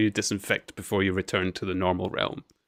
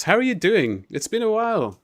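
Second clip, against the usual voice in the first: excited greeting; 10 semitones higher, 3 dB louder than usual. Real, not acted. Greeting a returning viewer.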